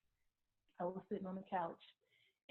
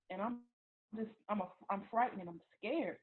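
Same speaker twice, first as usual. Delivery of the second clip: normal talking voice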